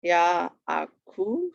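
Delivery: YAAKOV drawn out, ya-a-kov; syllable by syllable. Shown as a demonstration. Teacher showing students the accent, "Yaakov" slowly broken up. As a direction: sound out "Yaakov" slowly, rising at the end. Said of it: "accent on the last syllable".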